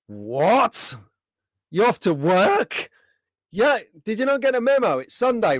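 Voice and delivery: high-pitched playful incredulity; teasing, exaggerated outrage at a mate; mock disbelief. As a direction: act scandalised, pitch leaping up. Mock shock at viewer working Sunday.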